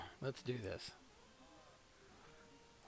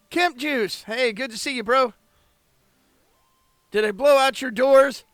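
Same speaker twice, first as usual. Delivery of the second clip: high, loud cheerful shout; loud greeting; 10 semitones higher, 14 dB louder than usual. Real, not acted. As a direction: call out to a buddy.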